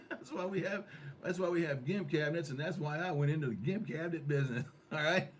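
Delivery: talking through laughter; can't stop laughing while talking. Real, not acted. Laughing through his own joke.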